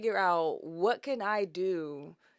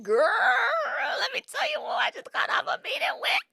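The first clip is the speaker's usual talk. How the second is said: high, loud, rushed excited gushing; excited squeal; 5 dB louder, faster than usual. Retold or imitated. Daughter running into her mama's room with news, excited squeal. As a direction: burst out shrieking with big news.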